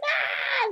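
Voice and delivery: high, loud mock cry; hammed-up high scream; imitated wail. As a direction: re-enact an overwhelmed movie-watcher's wail. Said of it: "we were like".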